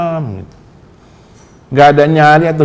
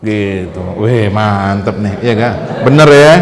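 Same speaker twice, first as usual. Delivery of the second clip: normal talking voice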